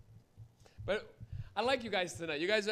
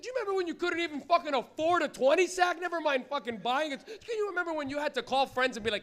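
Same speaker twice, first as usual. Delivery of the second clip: ordinary talk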